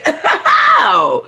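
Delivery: high burst of laughter; loud laugh. Real, not acted. Laughing loudly after botching the song.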